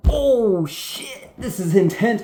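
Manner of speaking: high-pitched, drawn-in awe at the action; hammed-up thrilled reaction. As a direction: act out a viewer's excited alarm. Acts out viewer's thrilled reaction.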